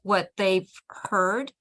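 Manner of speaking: ordinary delivery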